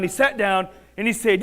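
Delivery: plain reading voice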